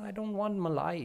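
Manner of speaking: put-on high little-boy refusal; fussy toddler refusing malai; fussy child. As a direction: pout through it.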